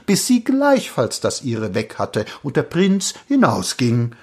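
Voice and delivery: plain reading voice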